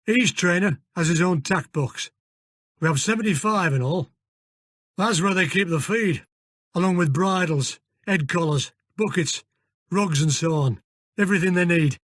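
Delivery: put-on broad northern English accent; gruff Yorkshire-style stable manager. Fergus voiced in northern accent.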